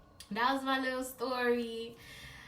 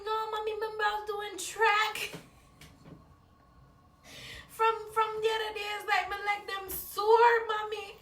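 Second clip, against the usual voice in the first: higher, sing-song excuse; excusing herself; 9 semitones higher than usual. Retold or imitated. Daughter excusing her limp to mom, higher whiny excuse. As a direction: sell the lie with a whine. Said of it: "I was like".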